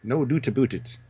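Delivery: mock Canadian, DOOT for "doubt"; Minnesotan-Canadian accent. Shown as a demonstration. Claiming to be a secret Canadian, mock Canadian accent, "aboot". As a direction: say it Minnesotan, ABOUT as "a-boot". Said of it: "She's got a Minnesotan accent".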